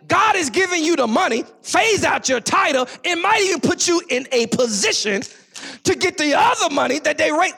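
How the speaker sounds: loud, high, slow preaching build; preaching at full force. Real, not acted. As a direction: drive it home to the church.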